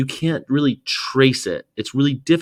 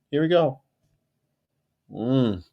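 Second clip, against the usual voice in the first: normal talking voice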